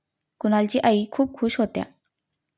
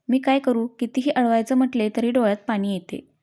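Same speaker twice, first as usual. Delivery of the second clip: ordinary delivery, nothing marked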